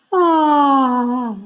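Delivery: loud, breathy sigh of delight; blue owl enchanted by the story; dreamy ah. A narrator's reading. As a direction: breathe out in wonder. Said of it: "breathed the blue owl dreamily".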